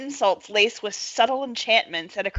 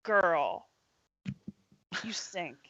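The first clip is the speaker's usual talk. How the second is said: acted insult; 8 dB quieter, slower than usual. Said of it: "I say".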